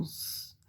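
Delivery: long sustained hiss for "S"; pure held sss on "S"; isolated S sound. Shown as a demonstration. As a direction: say "S" as a drawn-out sss, not the letter name. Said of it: "nous entendons le son S".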